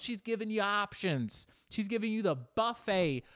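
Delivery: ordinary talk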